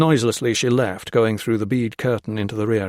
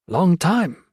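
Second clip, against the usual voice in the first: fond greeting; 7 semitones higher, 3 dB louder than usual. Acted. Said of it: "she said, warmed by his touch".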